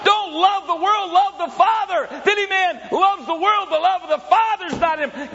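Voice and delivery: high, loud shouted preaching; preaching at full force. Acted. Preacher warning the congregation against the world, shouting.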